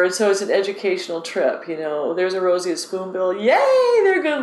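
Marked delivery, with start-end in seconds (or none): 3.3-4.4 very high, drawn-out little yay; gleeful cheer. Retold or imitated. Guide cheering a spoonbill with guests, gleeful. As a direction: cheer in a high, delighted squeal. Re-enacts cheering a bird sighting.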